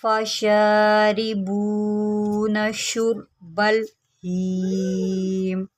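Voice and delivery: slow, drawn-out tajweed recitation; Quran recitation. Shown as a demonstration. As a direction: chant the verse slowly, held vowels. Recites the verse.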